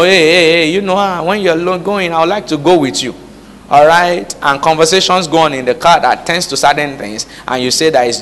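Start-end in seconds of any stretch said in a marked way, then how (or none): 0.0-3.1 higher, playful imitation of a coworker; mock flirty coworker. Preacher mimics a flirting colleague. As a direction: lightly play the flirt asking for a ride.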